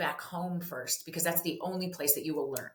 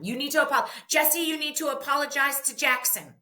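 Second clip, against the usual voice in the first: higher, louder parent ordering an apology; bossy parent order; 9 semitones higher, 5 dB louder than usual. Retold or imitated. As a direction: demand the apology like an exasperated parent. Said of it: "we do stupid stuff like this".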